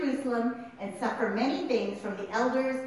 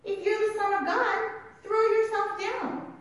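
devil; 9 semitones higher than usual. Acted. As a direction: dare him, in a lightly acted tempter's voice. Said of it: "The devil took him".

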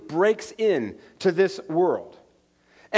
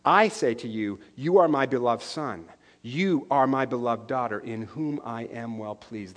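tender voice of Jesus; 8 semitones lower, 5 dB quieter than usual. Acted. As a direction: speak it gently as a promise of love. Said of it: "who says to us".

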